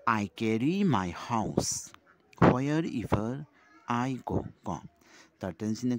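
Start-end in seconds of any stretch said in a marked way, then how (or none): 0.1-4.5 slow, raised classroom recitation; drawn-out poem lines for young pupils. Shown as a demonstration. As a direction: recite the verse slowly, lifting the voice.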